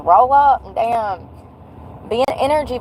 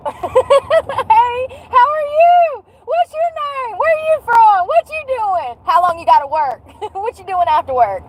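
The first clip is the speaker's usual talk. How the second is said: much higher, bubbly rapid-fire questions; gushing excited greeting; 14 semitones higher than usual. Retold or imitated. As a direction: gush like meeting a new best friend. Gushing over the sweet cashier.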